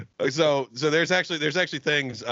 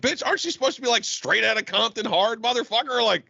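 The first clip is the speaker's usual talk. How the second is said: incredulous teasing; 8 semitones higher than usual. Retold or imitated. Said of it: "I'm like".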